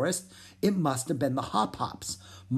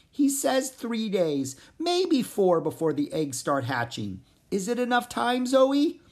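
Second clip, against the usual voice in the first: worried frog; 8 semitones higher than usual. Acted. Pip telling Zoe the deadline, worried and high. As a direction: break the bad news gently.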